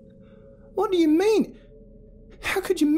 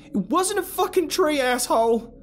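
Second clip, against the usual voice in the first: higher, louder furious retort; angry yell; 5 dB louder than usual. A narrator's reading. Carol yelling at Alex, furious. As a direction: lash out at him.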